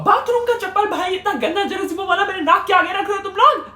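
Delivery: high, loud laughing protest; disgusted outburst. Real, not acted. Disgust at slipper under nose. (हँसते हुए घिन से चिल्लाना)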